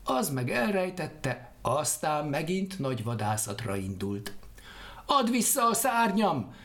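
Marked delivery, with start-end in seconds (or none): 5.1-6.5 high, loud cry; shouting after him. Acted. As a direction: yell after him as he leaves. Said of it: "kiáltott utána Lotilkó". (magas, hangos kiáltás utána)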